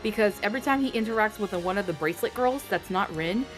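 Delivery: usual speaking voice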